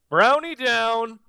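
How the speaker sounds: high, loud, drawn-out call; mock alarm call. Host voicing a player's call about the Browns, called out loud. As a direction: call it out like a comic distress alert.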